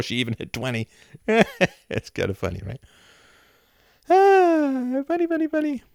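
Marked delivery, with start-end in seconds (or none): 4.1-5.8 high falsetto singsong; mock singsong. Streamer mocking his own joke in a high falsetto. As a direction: toss it off in a silly high falsetto.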